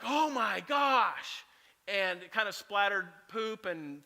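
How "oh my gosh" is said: slow, drawn-out mock horror; stunned gasp. Youth pastor recalling the bird hitting his windshield, slow stunned gasp. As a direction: let the shock stretch the words out slowly.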